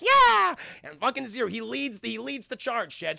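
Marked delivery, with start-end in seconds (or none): 0.0-0.5 high, loud doggy yelp; eager yapped agreement. Retold or imitated. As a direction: imitate a small excited dog. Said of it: "And [name] goes".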